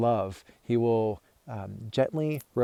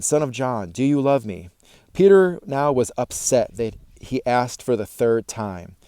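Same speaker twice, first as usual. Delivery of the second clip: louder, measured reading of a Bible passage; scripture reading; 5 dB louder than usual. Acted. Pastor reading John to viewers, measured and clear. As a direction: read the passage aloud to the congregation.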